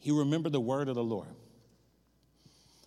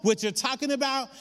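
higher-pitched retold protest; emphatic denial; 6 semitones higher than usual. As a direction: insist you know nothing, pitched up. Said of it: "And he said".

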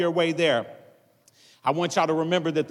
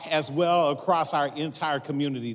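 ordinary talk

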